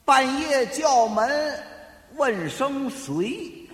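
very slow, drawn-out repetition; slow pondering. Acted. Guesser studies the riddle aloud, slow and pondering. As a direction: chew over each word slowly.

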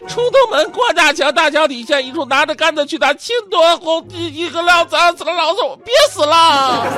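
put-on high, straining kid running out of breath; child voice. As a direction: squeak it like a kid gasping. Child attempts tongue twister.

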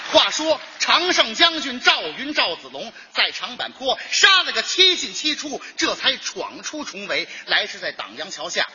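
lightly dramatic storyteller voice; pingshu storytelling. Acted. Performer recites traditional pingshu, lightly dramatic storyteller cadence. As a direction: tell the Three Kingdoms tale like a storyteller.

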